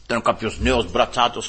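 ordinary talk

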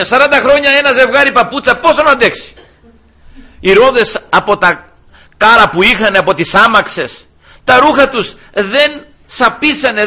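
full-force sermon, amazed and rousing; fiery preaching. Acted. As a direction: thunder out the wonders of the desert.

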